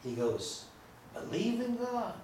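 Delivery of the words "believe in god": stretched, risen BELIEVE in God; Jung's stress on believe. Retold or imitated. Old Jung stressing the question back to the interviewer. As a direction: lean hard into believe. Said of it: "he emphasizes it differently".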